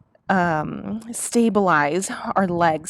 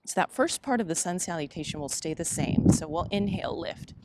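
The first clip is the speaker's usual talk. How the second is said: soft, unhurried flow cues; yoga cueing; 5 dB quieter than usual. Acted. Teacher cueing her yoga class, soft and unhurried. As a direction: guide the class through each breath.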